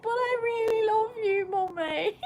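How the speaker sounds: high, weepy-laughing plea; high after surgery. Retold or imitated. Drugged daughter insisting to mum, tearfully loving. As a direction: imitate yourself being soppy and childlike. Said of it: "high after the surgery".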